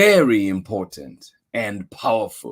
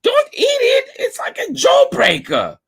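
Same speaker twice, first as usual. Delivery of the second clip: high, louder squeaky warning; squeaky mouse; 4 dB louder than usual. Acted. Mister Mouse warns Ganesha, high and squeaky. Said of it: "squeaked Mister Mouse".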